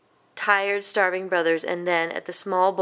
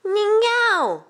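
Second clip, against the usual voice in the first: much higher, louder joyful shout; excited yell; 14 semitones higher, 5 dB louder than usual. Acted. Ming sees the ningao, high joyful shout. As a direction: shout the name of the treat in delight. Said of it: "yelled Ming".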